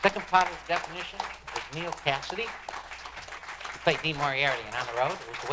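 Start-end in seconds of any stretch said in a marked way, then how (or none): none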